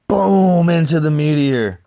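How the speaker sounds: high and loud, a sudden explosive boom; excited boom. Retold or imitated. Retelling the ship crashing into the meteor, loud excited boom. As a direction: slam the climax home.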